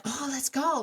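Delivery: high, eager dog voice; excited dog. Retold or imitated. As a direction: act out a dog raring to go. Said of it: "so excited to go back down".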